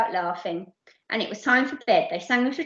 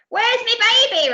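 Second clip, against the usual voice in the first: very high, slow, loud insisting demand; even louder boom; 11 semitones higher, 7 dB louder than usual. Acted. Doris repeats her demand louder and slower. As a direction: bellow it out impatiently. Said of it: "repeated Doris".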